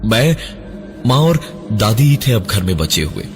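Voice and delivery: usual speaking voice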